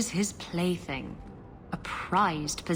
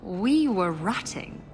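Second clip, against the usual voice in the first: scornful scoff; slower than usual. Acted. Scoffing at a lover who speaks of love, derisive laugh. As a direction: echo the word love, then a dismissive laugh.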